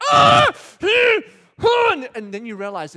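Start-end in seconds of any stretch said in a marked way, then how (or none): 0.1-1.9 much higher, much louder angry grunts; bursting with held-in fury; frustrated outburst. Retold or imitated. Husband fuming at his wife during the fight, angry grunts. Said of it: "and it's like".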